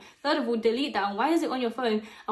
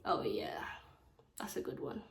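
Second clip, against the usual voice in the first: breathy whispered aside, barely audible; 9 semitones lower, 12 dB quieter than usual. Real, not acted. Aside to viewers, suddenly whispered under the breath. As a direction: drop to a whisper for a quick aside.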